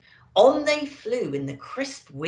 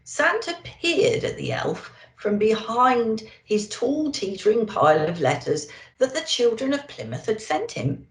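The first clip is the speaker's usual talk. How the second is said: plain reading voice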